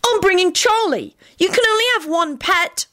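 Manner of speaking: put-on high, obstinate child's voice; stubborn. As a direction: dig in your heels. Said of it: "Timothy replied stubbornly".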